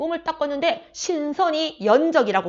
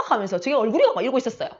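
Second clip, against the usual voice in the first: ordinary talk, nothing marked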